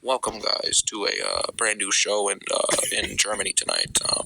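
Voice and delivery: very low, lifeless announcer voice; mock bored commentator. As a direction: drone it like a commentator with zero energy. Mocking dull commentator.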